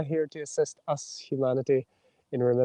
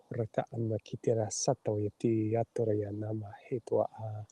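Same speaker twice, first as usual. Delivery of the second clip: soft channelled light language; 4 semitones lower, 6 dB quieter than usual. Acted.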